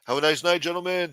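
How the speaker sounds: imitating a dismissive doorman; security guard. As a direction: turn them away like a club bouncer. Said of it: "Big-ass security guards used to say".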